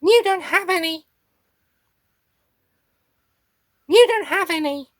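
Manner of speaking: very high little creature voice; Meep character voice. Acted. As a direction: squeak it in a Meep voice with an odd accent. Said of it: "I am beat the meep".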